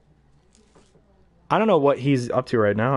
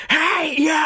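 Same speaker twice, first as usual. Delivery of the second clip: much higher shouted call; Grinch scream. Retold or imitated. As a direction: yell it out sharply. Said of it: "And he screams".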